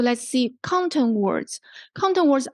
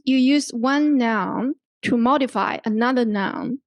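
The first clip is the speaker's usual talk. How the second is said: ordinary delivery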